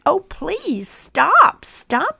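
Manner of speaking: high, pleading stop, stop; pleading cry. Acted. Teacup crying out against the fumes, high pleading. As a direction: beg him to stop. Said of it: "I cried".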